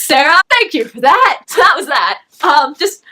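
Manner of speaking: usual speaking voice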